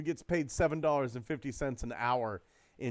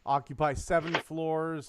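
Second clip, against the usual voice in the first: ordinary talk, nothing marked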